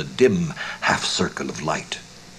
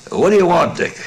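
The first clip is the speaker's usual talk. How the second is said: calling out loud and high across the house; host calling to his guest from the kitchen, cheerful loud call; 12 semitones higher, 8 dB louder than usual. Acted. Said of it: "the cheerful cry of Tony's voice".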